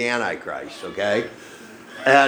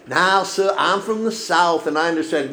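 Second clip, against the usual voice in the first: put-on Southern accent; 6 semitones higher than usual. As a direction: play the polite Southern lady from the IRS. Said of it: "with a southern accent".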